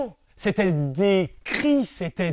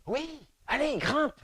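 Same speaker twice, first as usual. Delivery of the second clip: coaxing tree; 7 semitones higher, 4 dB quieter, slower than usual. Acted. Tree urges child.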